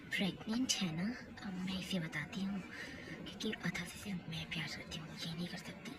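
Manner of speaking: hushed villainous aside; scheming whisper. Acted. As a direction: whisper the plot behind her back.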